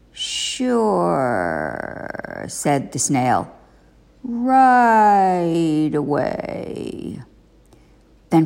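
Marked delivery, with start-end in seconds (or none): 0.1-2.0 snail voice. Acted. The snail answers Frog. 4.2-7.2 drawn-out, very slow snail voice; very slow slimy snail voice. Acted. The snail answers Frog.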